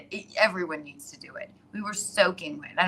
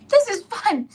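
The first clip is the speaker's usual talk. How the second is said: imitating Kiki; 9 dB louder than usual. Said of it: "Kiki be like".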